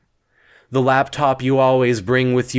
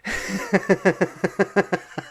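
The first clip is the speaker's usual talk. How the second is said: high, rapid staccato laugh; high-pitched laughter that keeps going; laughing fit; 6 semitones higher, 5 dB quieter than usual. Acted. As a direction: break into a long run of giggles. Laughing fit during final speech.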